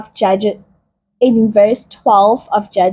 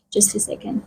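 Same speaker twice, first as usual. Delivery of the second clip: breathy off-mic whisper while catching breath; whispered aside; 11 dB quieter, faster than usual. Real, not acted. Preacher whispering to himself while catching his breath. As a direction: whisper it to yourself, much softer.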